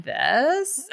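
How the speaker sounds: imitated young radio reporter's uptalk; drawn-out mock questioning lilt. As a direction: turn the statement into a question, mockingly. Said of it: "make every sentence a question".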